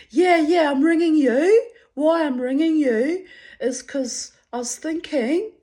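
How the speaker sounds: very high, loud, nervous mock voice; imitating an anxious man pushing his luck on the phone; anxious caller. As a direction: ham up his jittery pushing. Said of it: "you can hear the anxiety in his voice".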